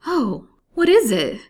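high, loud, drawn-out cry of alarm; terrified cry. A narrator's reading. Patient startled by a shriek next door, terrified. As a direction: cry out in surprise and terror. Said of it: "shuddering with surprise and terror".

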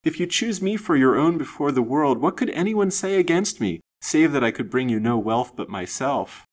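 high, fervent pleading; eager torrent. A narrator's reading. Lady offering herself to the silent man, eager, wild pleading. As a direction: press the appeal with rising passion. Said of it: "the wild torrent of words she poured forth so eagerly".

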